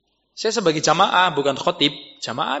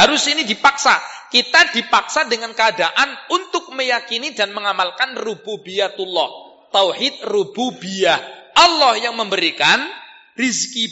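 raised, emphatic preacher's voice; higher-pitched, insistent preaching; forceful preaching; 8 semitones higher, 5 dB louder than usual. Acted. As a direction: drive the lesson home loudly.

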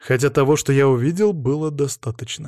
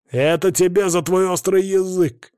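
higher-pitched angry outcry; crying out; 6 semitones higher, 3 dB louder than usual. A narrator's reading. Captor hits the bound prisoner for his cheek, crying out. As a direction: lash out with a raised voice. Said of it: "выкрикнул Михаил Петрович".

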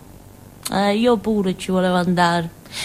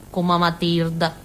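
usual speaking voice

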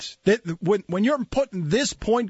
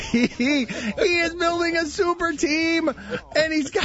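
laughing; 10 semitones higher than usual. Real, not acted. Laughing at his own joke.

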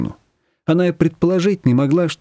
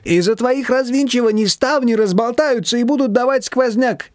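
high, plaintive fussing; fretting mother-in-law; 10 semitones higher than usual. A narrator's reading. Manana fretting at Nina, high and plaintive. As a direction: whine about the draught.

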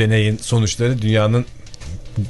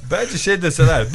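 normal talking voice

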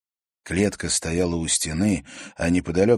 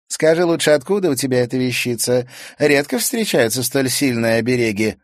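mocking girl; 6 semitones higher, 6 dB louder than usual. A narrator's reading. Sayana questions him.

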